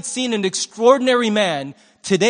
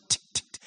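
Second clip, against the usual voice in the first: whispered "tick, tick, tick" like a clock; unvoiced clock-tick imitation; 10 dB quieter, much faster than usual. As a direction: tap out the ticks in a breathy whisper. Imitating cheap ticking clock.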